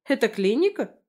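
taken-aback query; surprised. A narrator's reading. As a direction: ask it in surprise, pitch lifted. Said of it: "Удивилась, взглянув по сторонам".

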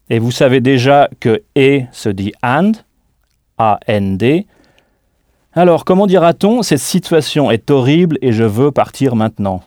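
normal talking voice

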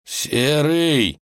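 high, drawn-out faint call; weak voice. Acted. Ivan calls up to the wolf from the chasm, weakly. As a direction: call feebly from far below. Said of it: "раздался слабый голос".

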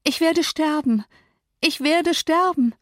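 high-pitched anguished wail; desperate cry. A narrator's reading. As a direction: wail it in despair. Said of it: "schrie sie". (hoch und verzweifelt klagend)

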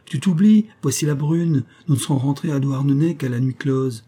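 hurried friend calling him home; breathless shout. A narrator's reading. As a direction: chide him while still winded. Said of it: "cria-t-il essoufflé".

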